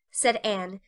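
ordinary delivery

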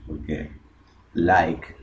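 ordinary talk

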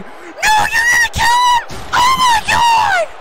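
very high, loud shriek; horrified scream. Real, not acted. Commentator fears Carter will kill him, shrieking in horror. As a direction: scream as if witnessing a killing.

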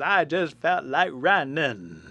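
singsong, drawn-out comic delivery; Forrest Gump. Retold or imitated. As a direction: stretch each word in a film-character impression. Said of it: "give that line where he says".

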